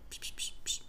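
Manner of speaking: soft unvoiced psh psh of a musher; quiet imitated mushing noise; whispered mushing sounds. Shown as a demonstration. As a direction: breathe out psh psh like driving sled dogs. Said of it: "What's the word?".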